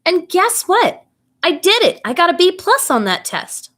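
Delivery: put-on a kid bursting with pride at the B plus; excited. A narrator's reading. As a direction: announce the good grade like big news. Kid proudly reveals passing test.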